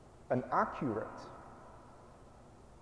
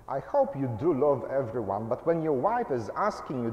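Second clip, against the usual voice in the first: usual speaking voice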